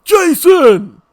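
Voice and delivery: the skinwalker calling out, shouting; deep distant shout. Retold or imitated. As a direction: yell the name like a voice out in the dark. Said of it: "And then to hear".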